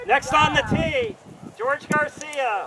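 slow, booming introduction; announcer. Acted. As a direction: call the golfer to the tee. Announcing next golfer.

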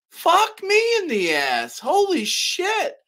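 very high, slow, stretched-out disbelief; exasperated disbelief. Retold or imitated. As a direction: squeal it out slowly, as if you can't believe the nerve. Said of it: "I say".

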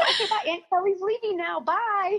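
high, playful sing-song farewell; cheerful goodbye. Retold or imitated. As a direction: breezily wave the kids off, laughing. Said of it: "I go".

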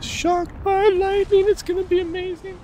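gleeful squeal. Real, not acted. Gleeful about friend getting shocked.

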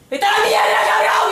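grito agudo y muy fuerte; furious shout. Retold or imitated. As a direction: chilla el insulto con rabia. Grita a Antonio en la calle. (grito furioso en la calle)

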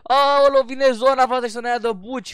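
loud, high cry of alarm; mock-panicked warning. Real, not acted. Player warns teammate the zone is coming, mock-panicked warning. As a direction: call out in playful panic, stretching the last words.

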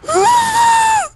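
high, loud crying wail of defeat; frustrated wail. Real, not acted. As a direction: wail out in despair at losing again. Fails again in the game. (lamento choroso e agudo de frustração)

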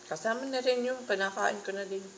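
talking with mouthful of fruit; words muffled by food; chewing while talking. Real, not acted. Seen 0: Hand at mouth eating rambutan; mouth working while speaking.

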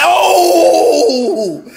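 long high wailing "oh"; stretched, hammy high cry; drawn-out cry. Pretend. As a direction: let out a long, high howl. Drawn-out cry opening the skit.

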